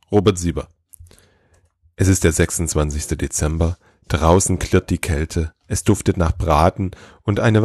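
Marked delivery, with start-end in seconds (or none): none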